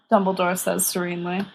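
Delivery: ordinary delivery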